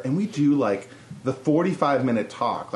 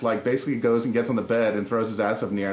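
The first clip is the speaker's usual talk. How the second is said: usual speaking voice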